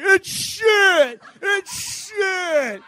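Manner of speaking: loud, high, repeated mock yell; hammed-up scream at the mess; imitating a man shouting at the foam; yelling declaration. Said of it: "yelling at it".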